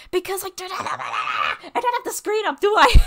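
defensive shout. Real, not acted. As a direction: shout back at the chat. Defensive outburst at chat.

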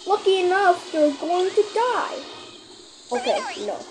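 normal talking voice

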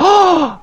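high, sudden intake of breath; sharp gasp. Real, not acted. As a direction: gasp in shocked anticipation. Reacts to friend's game attempt.

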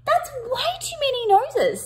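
high, astonished squeal over the noses; shocked. Acted. Kipper finds too many noses, high astonished squeal. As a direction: gasp it out in disbelief. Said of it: "said Kipper".

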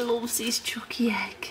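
soft, breath-only teasing; playful whisper. Pretend. Partner teasing him about his egg, playful whisper. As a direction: whisper it like a silly secret. Teasing baby talk, first take.

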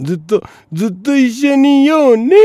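high, loud sing-song promise; mock lovey girlfriend. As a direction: coo it like a lovestruck teen. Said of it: "大体そういうもんやね".

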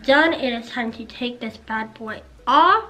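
talking through a face mask; wearing a sheet face mask. Real, not acted. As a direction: lips framed by wet mask. Seen 0: Wet sheet mask covers whole face while talking, peeled off after.

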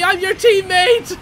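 high, loud and slow, half-crying protest; whiny outcry. Real, not acted. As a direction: drag out teammate in a wounded wail. Teammate keeps hitting player.

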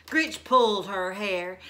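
usual speaking voice

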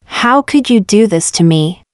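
ordinary talk

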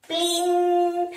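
high ringing "pling", held long; sung "pling". Pretend. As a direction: chime it like a little bell. Imitated ping when pressing.

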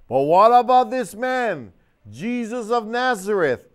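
high, slow, loud appeal to the crowd; Pilate pleading. Acted. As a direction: plead with the mob for this man.

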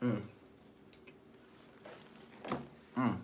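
chewing pizza, cheeks bulging; humming through mouthful; mouth full chewing. Real, not acted. Seen 1: cheeks puffed with pizza, chewing after big bites, slice held.

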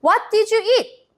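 very slow question pitched high; slow, high model question. Shown as a demonstration. Teacher asking the children the question slowly. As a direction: ask it slowly and brightly for the kids. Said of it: "먼저 선생님이 질문합니다".

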